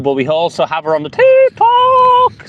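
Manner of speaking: squeaky high, slow and loud on TikTok; high falsetto call. Acted. Asking TikTok viewers to hold on, in a high falsetto. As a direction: pipe up like a jingle. Announcing the livestream platforms.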